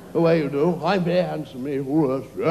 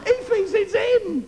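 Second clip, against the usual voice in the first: gleeful mock; 12 semitones higher, 4 dB louder than usual. Mocking the slave Lance.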